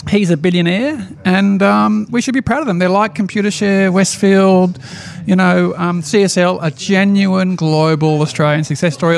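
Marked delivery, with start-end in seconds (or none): none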